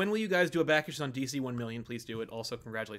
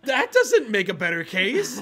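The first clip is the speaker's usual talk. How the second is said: high, loud incredulous comeback through laughter; loud mock retort; 7 semitones higher, 11 dB louder, slower than usual. Retelling his answer to the guy behind, loudly incredulous. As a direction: exclaim it, amused and drawn out.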